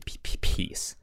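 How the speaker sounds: usual speaking voice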